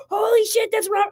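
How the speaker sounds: high, loud excited shriek, re-enacted; starstruck shriek. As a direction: blurt it out like a shrieking fan. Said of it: "I literally shrieked".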